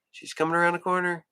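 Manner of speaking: ordinary talk, nothing marked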